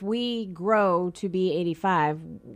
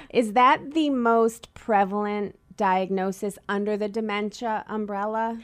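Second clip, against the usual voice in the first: ordinary delivery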